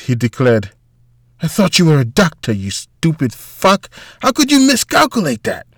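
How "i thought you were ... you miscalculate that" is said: quick accusation leaping to a high-pitched outburst; angry bellow. A narrator's reading. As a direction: berate him, building to an outraged cry. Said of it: "Walter bellowed".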